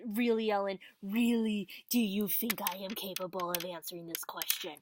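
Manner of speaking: loud, incredulous really Ellen; hammed-up outrage at an impossible question; mock exasperation. Vlogger to viewer Ellen about a hard question, mock exasperated. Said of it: "mock exasperation at question".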